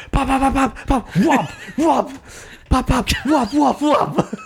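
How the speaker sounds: high squeaky pop and whop noises for punches landing; mock punch sounds. Opponent's punches landing on partner, high mock pop sounds. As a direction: mimic the opponent's punches with high little pops. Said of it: "We just hear, like".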